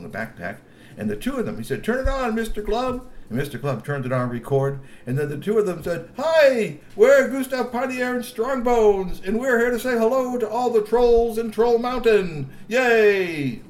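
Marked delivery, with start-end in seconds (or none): 1.8-3.1 high eager G P voice. A narrator's reading. G P commands. 6.2-13.6 high, louder, slow cheery announcement; cheery camera greeting. A narrator's reading. G P and Strongbones recording a cheery hello, high and loud.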